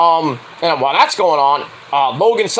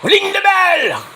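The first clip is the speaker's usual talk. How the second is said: very high, drawn-out command; hammed-up impression, stretching the last word; Dario Cueto; 13 semitones higher, slower than usual. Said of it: "like Dario Cueto would say".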